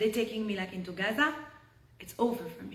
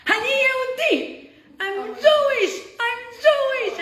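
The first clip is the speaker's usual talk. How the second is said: desperate shout; 12 semitones higher, 7 dB louder than usual. Retold or imitated. Hostage bursting from the bush, yelling to soldiers, high desperate shout. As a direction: shout it like your life depends on it. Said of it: "he says".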